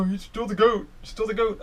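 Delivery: mock the die-hard friend who won't budge; imitating a Patriots superfan. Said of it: "my friend's, like, a huge Pat".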